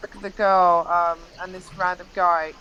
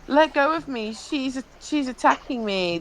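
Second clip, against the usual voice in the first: higher-pitched imitated plea; mild mock distress, pitch raised; retold protest; 5 semitones higher than usual. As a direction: lightly mimic someone protesting being grabbed. Quotes woman protesting being held.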